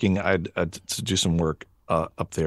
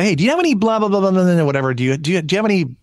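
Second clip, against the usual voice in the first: imitated daughter's curt drive-thru order, pitched up; short, brusque ordering; 5 semitones higher, 3 dB louder than usual. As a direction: rattle it off in a higher voice, all business. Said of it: "she's kinda short about that".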